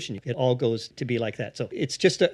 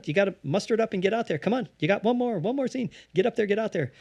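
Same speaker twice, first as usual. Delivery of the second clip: higher, quicker urging to yourself; self pep talk; 7 semitones higher, 4 dB quieter, faster than usual. Retold or imitated. Improviser urging himself to do one more scene, higher pep talk. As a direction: egg yourself on to go on stage.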